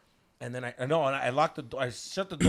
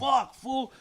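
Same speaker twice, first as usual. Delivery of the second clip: loud, high acted outburst; alarmed curse; 10 semitones higher, 7 dB louder than usual. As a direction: swear as the trouble sinks in. Reacting to son passed out.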